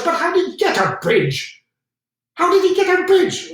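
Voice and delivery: rising into a furious high shout; angry shouting. Acted. Furious commander questions subordinate.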